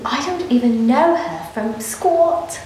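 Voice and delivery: usual speaking voice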